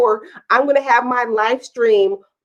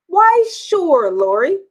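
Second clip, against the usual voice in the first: sing-song sure; cheerful mock-gracious answer; playful; 6 semitones higher, 3 dB louder, slower than usual. Real, not acted. Playful mock-formal reply.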